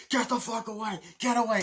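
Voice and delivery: imitated frantic command; acted angry order. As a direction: drive them back in mock fury. Acting armed standoff in film.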